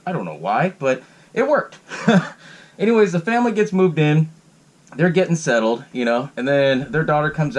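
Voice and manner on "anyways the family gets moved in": normal talking voice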